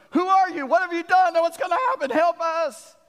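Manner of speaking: high, loud frantic pleading; terrified crew crying for answers; panicked sailors. Acted. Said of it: "These guys are in this panic state".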